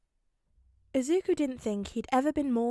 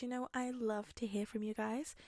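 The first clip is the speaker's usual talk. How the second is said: ordinary delivery, nothing marked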